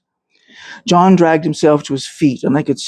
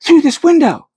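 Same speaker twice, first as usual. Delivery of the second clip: put-on much higher young woman's hushed urgency; girl's urgent call; 11 semitones higher than usual. A narrator's reading. A girl guides Thomas to a window, urgent. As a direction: call him over quickly as a young girl. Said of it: "heard a female voice say".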